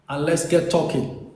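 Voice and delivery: ordinary delivery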